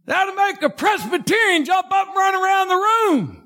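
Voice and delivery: high, ringing preacher's cry; full-force sermon climax; fervent preaching. Real, not acted. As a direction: lift the church up with it.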